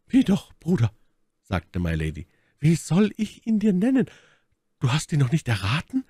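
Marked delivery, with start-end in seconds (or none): 0.1-0.9 hohe Frauenrolle; Milady, fragend. Acted. Milady fragt Felton in Rollenstimme. 2.6-6.0 hohe, fragende Frauenrolle; Milady, herausfordernd. Acted.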